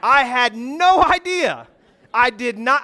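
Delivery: hammed-up fake astonishment; God teasing Moses with mock surprise; sarcastic God. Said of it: "And God's like".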